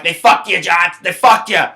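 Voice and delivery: usual speaking voice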